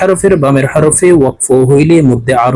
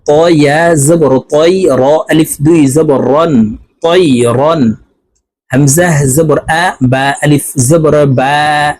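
ordinary talk

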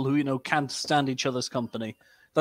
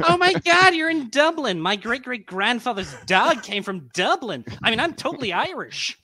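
put-on a much higher, gushing American tourist accent; 8 semitones higher than usual. Mocking Yanks who claim Irish roots, high American accent. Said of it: "Oh, that's a good American accent".

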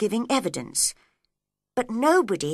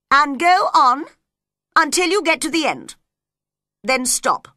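loud, raised royal instruction; commanding king; 6 semitones higher, 8 dB louder than usual. Acted. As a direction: lay down the order firmly and loudly.

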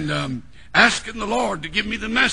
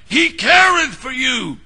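shouted louder, "careth" hit hard; preached shout; 4 semitones higher, 5 dB louder than usual. Acted. Preacher proclaiming his four-word text, shouting. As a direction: cry the text out to the church.

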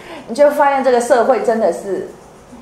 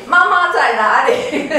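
put-on child asking for mom; 8 semitones higher, slower than usual. Child immediately asks for mom.

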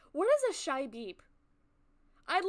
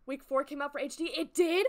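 ordinary talk, nothing marked

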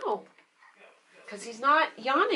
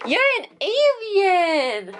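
higher, louder, drawn out; delighted exclamation; 8 semitones higher, 5 dB louder, slower than usual. Real, not acted. Mom marvelling at her strong baby, high and delighted.

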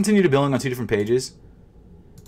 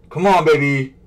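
loud pleading; 6 semitones higher, 8 dB louder than usual. Real, not acted. Loud pleading with checkout page.

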